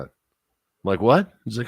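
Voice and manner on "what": mock surprised what. Teller's retold disbelief.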